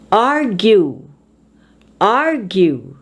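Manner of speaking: "Argue" with "ar" strong; first-syllable stress. Shown as a demonstration. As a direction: hit the start of "Argue", then fall. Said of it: "on the first syllable".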